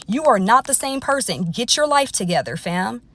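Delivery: ordinary talk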